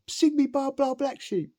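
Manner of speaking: high, loud, slow mocking demand; taunting inmate. Inmate demands a nursery rhyme, high loud taunt. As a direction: drag the song title out high and loud. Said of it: "That was the one".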